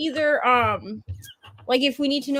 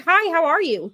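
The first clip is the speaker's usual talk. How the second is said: bright, lifted drive-thru hello; chirpy, upbeat welcome; cheery greeting; 5 semitones higher, 4 dB louder than usual. Retold or imitated. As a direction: greet them like a friend, pitched up. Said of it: "how cheery I sound".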